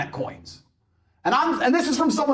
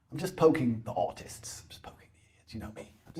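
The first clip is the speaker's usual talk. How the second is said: mock whisper; 7 semitones lower, 9 dB quieter than usual. Real, not acted. Teasing aside about crypto critics.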